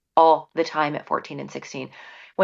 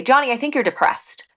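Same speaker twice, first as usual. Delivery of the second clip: blunt labeling parent; 7 semitones higher, 4 dB louder than usual. Retold or imitated. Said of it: "parents come in and say".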